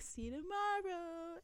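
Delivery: high, soft, drawn-out farewell; retold group goodbye. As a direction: imitate boys calling back a sweet, slow goodbye.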